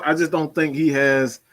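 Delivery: normal talking voice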